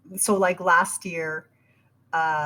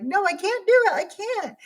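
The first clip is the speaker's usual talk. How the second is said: high, strained protest against herself; anguished self-talk; 11 semitones higher than usual. Retold or imitated. Arguing with herself, a high whiny no. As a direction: whine it like she's refusing herself.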